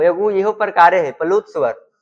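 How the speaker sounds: ordinary talk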